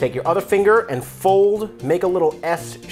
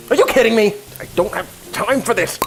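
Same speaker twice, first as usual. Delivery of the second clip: exasperated outburst; 7 semitones higher, faster than usual. Real, not acted. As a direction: throw up your hands in disbelief. Unopened sauce bottle, frustrated outburst.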